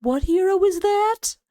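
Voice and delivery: high voice climbing through the question; squinting confusion. Acted. Caster mimicking himself blinded by his lights, high puzzled question. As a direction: stretch it upward, slow and puzzled. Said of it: "and I'm just like".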